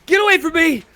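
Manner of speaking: high, loud frantic shouting; high frantic yelling. Pretend. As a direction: shriek it at the chicken. Reenacting chicken scene.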